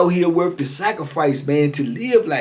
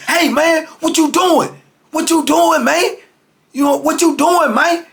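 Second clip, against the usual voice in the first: retold high yell; 11 semitones higher than usual. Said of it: "I done seen him go to the window".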